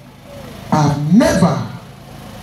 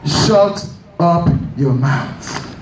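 slow stern rebuke; 2 semitones lower, much slower than usual. Retold or imitated. Preacher rebuking slowly.

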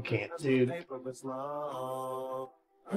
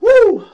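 high, loud "woo"; real celebratory holler; victory whoop; 7 dB louder than usual. Real, not acted. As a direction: let out a piercing whoop. Celebrating a win.

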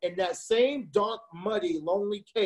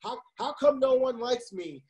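retold lament; 6 semitones higher, slower than usual. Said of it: "Then he says".

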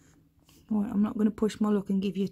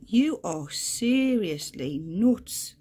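drawn-out teasing; 8 dB louder, much slower than usual. Real, not acted. Angry spider in threat posture.

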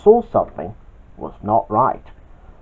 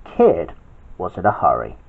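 ordinary delivery

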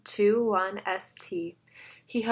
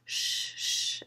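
soft whispered shush; soothing shush; 4 dB quieter than usual. A narrator's reading. Emily calming a panicked kid, soft whispered shush. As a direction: hush him gently. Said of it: "Emily soothed him".

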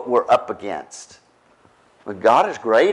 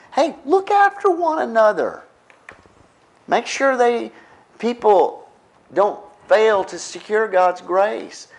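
much higher, pleading voice for "look after one another"; urgent plea; 9 semitones higher than usual. Retold or imitated. Preacher voicing scripture to the church, high and pleading. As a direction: call out high like a pastor pleading. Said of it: "he's telling us in the body of Christ".

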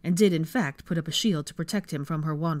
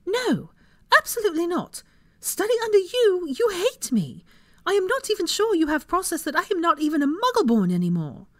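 high, loud, outraged refusal; furious outburst; 13 semitones higher, 6 dB louder than usual. A narrator's reading. Hermione refusing to study under him, furious. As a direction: let the pitch soar in indignant protest. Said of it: "crackling with her anger".

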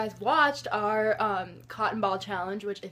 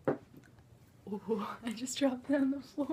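whispering; 8 dB quieter, slower than usual. Real, not acted. Laughing after dropping food.

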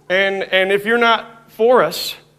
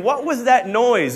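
normal talking voice

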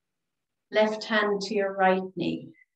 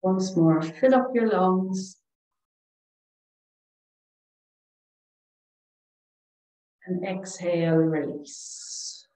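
calm, soothing relaxation voice with long pauses; guided meditation; 2 semitones lower than usual. Real, not acted. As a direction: guide them gently through each breath, leaving space. Leads breathing and meditation.

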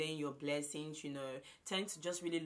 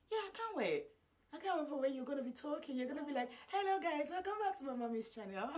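put-on baby voice; 10 semitones higher than usual. Voicing baby son's future words.

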